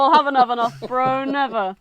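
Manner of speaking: loud, acted boast; brash, hammed-up refusal; mock bravado. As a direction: play the defiant gun lover. Voicing America's boast about his guns.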